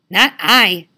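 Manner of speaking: loud, sighing pig voice. Acted. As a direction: sigh it out like a pig. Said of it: "sighed the pig".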